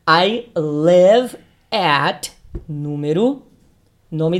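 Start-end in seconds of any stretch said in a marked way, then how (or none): 0.0-2.3 "I live at" very slow and louder; slow, clear teacher model; model phrase. Shown as a demonstration. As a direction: stretch out "I live at" for learners. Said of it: "aqui eu criei outra resposta".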